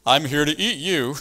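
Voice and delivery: preacher playing the wolf answering the sheep, menacing. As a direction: threaten the sheep like a hungry wolf. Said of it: "what's Mister Wolf gonna say back".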